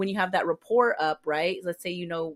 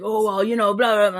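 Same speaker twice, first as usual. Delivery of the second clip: mocking inmate; 4 semitones higher, 9 dB louder than usual. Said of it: "guys would give me issues like".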